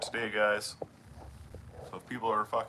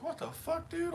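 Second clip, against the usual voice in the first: much higher, mock disbelief; retold surprise. Telling chat about the stranger's sweatshirt, with mock high disbelief. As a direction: replay the shock of seeing the sweatshirt. Retelling meeting the kid.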